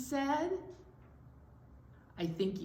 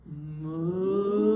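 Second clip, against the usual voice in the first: long low moo swelling louder; cow moo; 4 semitones lower, 5 dB louder than usual. Shown as a demonstration. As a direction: draw out a moo that grows loud. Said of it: "Could you say that with me?".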